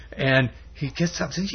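normal talking voice

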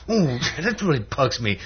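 high, cartoonish exasperation; irritated. Pink Panther fed up with neighbour's branch, irritated. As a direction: let mock irritation burst out. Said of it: "And the guy says".

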